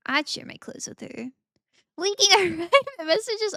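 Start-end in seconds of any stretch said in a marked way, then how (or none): 2.0-3.6 high, loud, scandalised gasp; mock shock. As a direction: play up the betrayal. Reading chat, mock shock.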